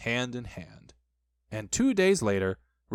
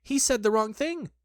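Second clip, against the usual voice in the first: high-pitched anxious worry; worried squeaky thought; anxious Piglet; 9 semitones higher than usual. A narrator's reading. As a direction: fret in a small high voice. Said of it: "thought Piglet anxiously".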